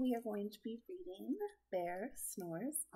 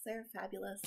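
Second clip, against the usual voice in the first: usual speaking voice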